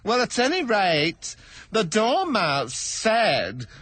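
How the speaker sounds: high, slow stammering plea; timid hatter begging pardon; nervous hatter. Acted. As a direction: stammer it out, afraid of the king. Hatter continues.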